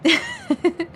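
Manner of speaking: short real laugh, falling away; amused laugh. Real, not acted. Laughing at a chat joke, short amused laugh.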